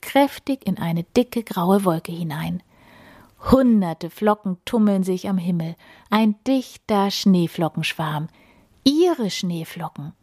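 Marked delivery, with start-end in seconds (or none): none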